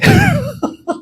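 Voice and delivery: high squealing laugh. Real, not acted. Preacher cracking up at the story, high squeal.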